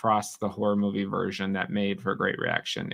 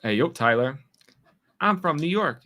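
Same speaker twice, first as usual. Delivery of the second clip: ordinary talk, nothing marked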